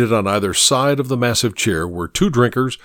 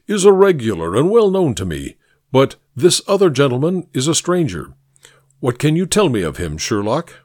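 pompous senator oratory; 4 semitones higher, faster than usual. Acted. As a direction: hold court like a senator. Mycroft voiced.

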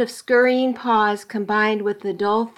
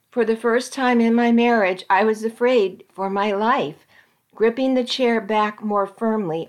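usual speaking voice

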